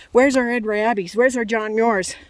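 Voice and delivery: normal talking voice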